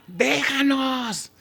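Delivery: high, stretched-out screech; acted screech, long and high but held back in volume; demon's cry. Someone suddenly rises in the synagogue, high demonic cry. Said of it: "de repente alguien por ahí se levanta y le grita".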